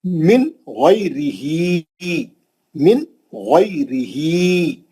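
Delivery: slow मिन गैरिही, short n, long final ee; मिन unhummed, गैरिही drawn out at the end; clear nun before ghain. Shown as a demonstration. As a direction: recite मिन गैरिही with a heavy throaty gh. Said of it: "नून साकिन के बाद गैन आ गया".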